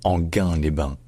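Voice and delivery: Anguin-les-Bains with hard g, like French gain; Anguin-les-Bains, hard g, no i glide; correct pronunciation. Shown as a demonstration. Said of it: "Donc ça se dit".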